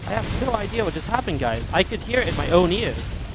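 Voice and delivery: ordinary delivery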